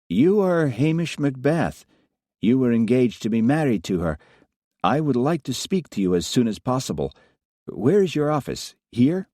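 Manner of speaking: put-on Russian woman with a faint American accent, crisp and formal; lightly voiced Russian inspector, a hint of American accent. Russian inspector questioning Hamish, faint American accent. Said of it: "faint tones of an American accent".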